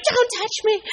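retold scream. Kneeling by her brother's bed, yelling at the nurse who touched her, a high startled shout. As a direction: snap it out like you've been startled mid-prayer. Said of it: "I screamed at her".

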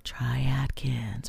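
slow, soft murmur in his ear; imagined whisper. Pretend. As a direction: lean in and breathe it slowly. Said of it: "he leans into whisper again".

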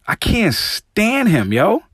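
slow, high and pressed; disgusted. Real, not acted. Host venting about the commentator, disgusted. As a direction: drag out the disgust.

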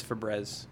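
"Febreze" said as "feh-BREZ", short e; short-e mispronunciation. Shown as a demonstration. Friend reacts to a lost double E, "Febreze" said "feh-BREZ". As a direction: rhyme the end of "Febreze" with "fez". Follows spelling with one E.

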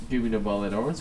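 normal talking voice

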